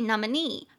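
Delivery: "nominee" with a stressed final NEE; stress on last syllable. Shown as a demonstration. Teacher showing stress in "nominee", stressing NEE. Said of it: "重音节在最后".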